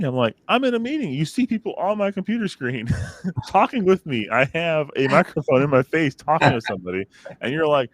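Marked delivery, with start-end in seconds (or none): 0.5-7.0 put-on higher, exasperated retelling to the kids; mock exasperated dad. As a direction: play up the disbelief at being interrupted. Dad scolding kids who barge in.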